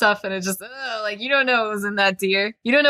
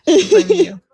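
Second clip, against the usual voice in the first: higher and louder, hammed-up blame; mock accusing shout; 10 semitones higher, 9 dB louder than usual. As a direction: point the finger in play, loud. Mock blame, then repeated louder.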